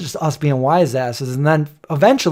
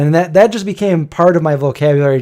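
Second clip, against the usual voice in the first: ordinary talk, nothing marked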